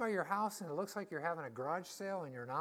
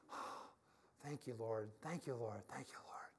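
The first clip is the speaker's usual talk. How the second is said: hushed prayer; 9 semitones lower, 9 dB quieter than usual. Retold or imitated. Retelling Jesse's prayer of thanks.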